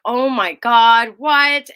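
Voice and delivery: loud, slow stunned gasp of disbelief; shocked realization. Retold or imitated. Hearing a friend's hard truth, loud stunned disbelief. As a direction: let it hit you all at once. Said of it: "and I was like".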